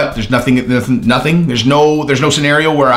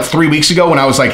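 ordinary talk

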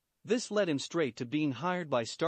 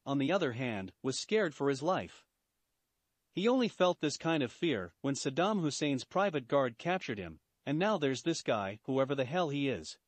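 ordinary delivery, nothing marked

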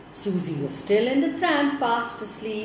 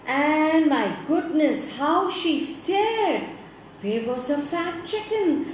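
amazed storytelling; 6 semitones higher than usual. A narrator's reading. Storyteller building astonishment.

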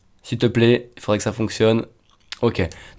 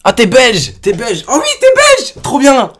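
high, loud excited exclamations; delighted; 7 semitones higher, 4 dB louder, slower than usual. Real, not acted. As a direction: squeal with delight at the discovery. Delighted the caller is Belgian.

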